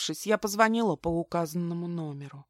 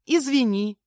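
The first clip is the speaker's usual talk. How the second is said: breezy, raised apology without regret; unapologetic; 7 semitones higher, 8 dB louder than usual. A narrator's reading. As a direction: apologise lightly, not sorry at all. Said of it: "без капли сожаления сказала я". (без капли сожаления)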